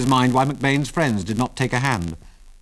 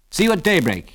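higher-pitched parting call; 8 semitones higher, 4 dB louder than usual. A narrator's reading. Pilot to old prospector on leaving, calling out. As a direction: call it across the room. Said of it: "he called".

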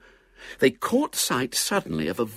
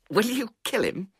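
high-pitched, awestruck question; awed; 12 semitones higher than usual. A narrator's reading. Squire to his young knight, awed. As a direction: ask it high, in awe. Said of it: "said the awed squire".

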